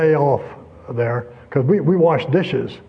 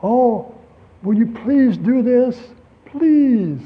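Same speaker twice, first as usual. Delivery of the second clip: higher, slow coaxing please; arm-around pleading; 7 semitones higher, much slower than usual. Retold or imitated. Paul pleading tenderly with a friend, pitched higher. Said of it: "like he had his arm around him".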